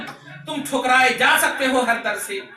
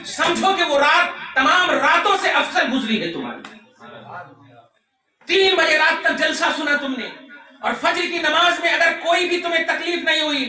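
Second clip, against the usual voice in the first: high, impassioned sermon delivery; preaching at full force; 6 semitones higher, 4 dB louder than usual. Real, not acted. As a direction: drive it home to the congregation. (जोश भरी ऊँची तक़रीर)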